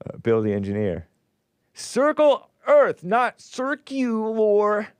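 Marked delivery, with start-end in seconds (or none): 1.7-4.9 Circle Earth said high and slow, circular drawn out; slow emphatic correction. Shown as a demonstration. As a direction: spell out the right word slowly, much higher. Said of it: "as mouth breathers call it".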